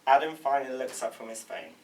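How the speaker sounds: ordinary talk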